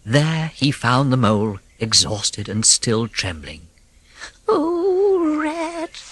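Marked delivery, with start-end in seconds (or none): 4.5-6.0 high, frightened small voice; crying out. A narrator's reading. Mole crying out to his rescuer, frightened. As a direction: relief through fear. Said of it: "he cried".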